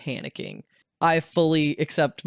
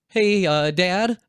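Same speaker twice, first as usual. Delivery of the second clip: raised, louder, hesitant greeting; sheepish, higher-pitched mock panic; retold nervous call; 5 semitones higher, 4 dB louder, much slower than usual. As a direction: imitate nervously breaking bad news to dad. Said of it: "freaking the hell out".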